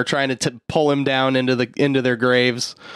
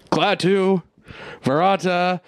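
mock cough mumble; 6 semitones higher than usual. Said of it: "the cough mumble".

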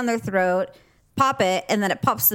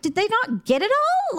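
incredulous; 13 semitones higher than usual. Retold or imitated. Quoting herself about the cat.

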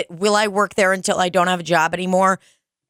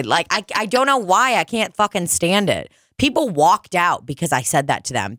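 normal talking voice

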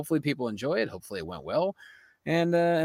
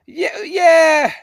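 loud eager yeah; 11 semitones higher, 10 dB louder than usual. Real, not acted.

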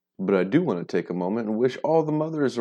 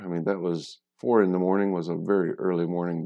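normal talking voice

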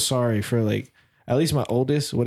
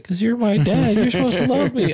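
high, louder imitation of a pleading kid, half laughing; mock-whiny child's protest, pitched way up; 10 semitones higher, 3 dB louder than usual. Child objecting to dad being the bully, high mock protest.

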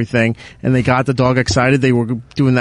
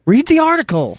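sharp snap; 13 semitones higher than usual. Real, not acted. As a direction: bite his head off. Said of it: "You're the most angry producer".

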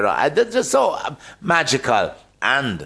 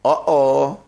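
higher, brighter Jamaican patois phone message; 4 semitones higher than usual. Shown as a demonstration. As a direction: perform the Jamaican patois card prompt with relish. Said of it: "can you give me a little rendition".

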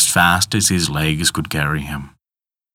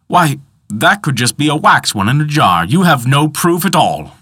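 higher, louder scoffing man; high protesting voice; 9 semitones higher, 5 dB louder than usual. Acted. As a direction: scoff at the claim. Peter protests.

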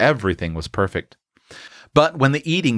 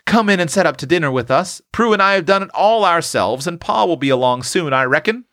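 put-on higher, hurried country-girl invitation; crying out; 8 semitones higher than usual. A narrator's reading. Tilly invites the visitor while escaping, higher eager cry. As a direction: call them in to dinner, pitched up. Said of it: "cried Tilly, trying to escape".

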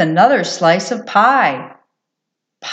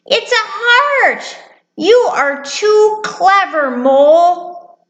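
high, slow, admiring mouse voice; delighted little mouse; 11 semitones higher, slower than usual. Acted. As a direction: marvel in a tiny mouse voice. Mouse delighted by paper heart.

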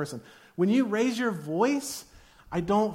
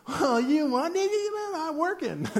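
mock flustered spouse; 9 semitones higher than usual. Spouse sputters in quarrel.

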